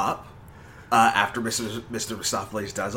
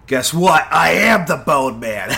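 loud, bright reveal; excited announcement; 5 semitones higher, 9 dB louder than usual. Acted. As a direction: belt it like a big reveal. Announcing his silly persona.

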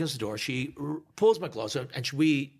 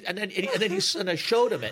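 usual speaking voice

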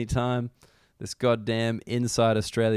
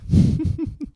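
high breathy laugh; 5 dB louder than usual. Real, not acted. Laughing at his own joke.

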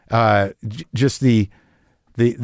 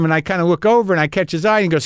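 ordinary talk

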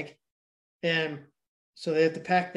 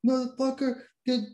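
hammed-up desperate shout; mock yelling; 8 semitones higher, slower than usual. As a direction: yell it in a mock panic over the plane noise. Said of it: "he's yelling his kid and his wife's name".

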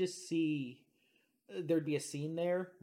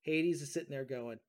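ordinary talk

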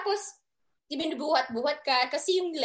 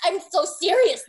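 ordinary talk, nothing marked

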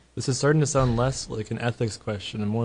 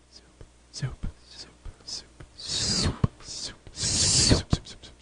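whispered, flat robotic chant; monotone soup voice; 3 semitones higher, 4 dB quieter, slower than usual. Pretend. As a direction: drone the word like a machine. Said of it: "you hear a monotone voice saying".

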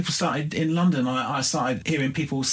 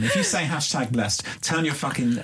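usual speaking voice

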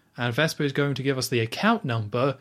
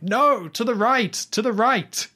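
high, loud mock yell of pain; playful pained shout; retold shout; 10 semitones higher, 4 dB louder, slower than usual. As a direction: yell it out like he's being hit. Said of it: "Bond starts shouting".

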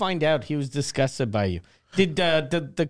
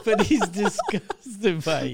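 slow, higher, laughing punchline; laughing mockery; 4 semitones higher, slower than usual. Real, not acted. Co-host teasing the dreamer about his lawyer, slowly, laughing. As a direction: draw out the tease through laughter. Friend teases the teller, laughing.

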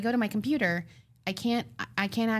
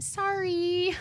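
high, drawn-out mock apology; sheepish apology; 11 semitones higher than usual. Woman learning a friend scared strangers, sheepish mock apology. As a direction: squeak it out sheepishly, stretching the word.

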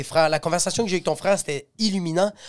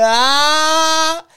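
cri aigu imité; 10 semitones higher, 7 dB louder than usual. Retold or imitated.

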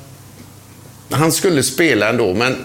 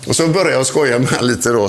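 ordinary talk, nothing marked

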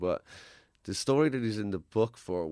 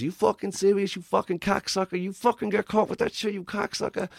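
much higher, strained gangster rant; mock furious wiseguy; 10 semitones higher than usual. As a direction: spit it like an outraged mobster. Alfie erupting, imitated.

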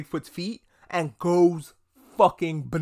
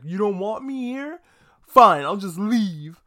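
higher pitched, put-on voice, then a louder, slow "fine, I'll just leave"; huffy Bigfoot sulk; 5 semitones higher, 4 dB louder, slower than usual. Harry acts hurt at the family who want him gone, in a mock-sulky put-on voice. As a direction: sulk off like an offended kid. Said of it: "And Harry's like".

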